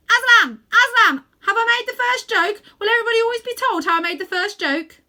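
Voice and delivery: very loud, high squawking bird; excited jackdaw. A narrator's reading. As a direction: blurt it out breathlessly proud. Jackdaw eager question.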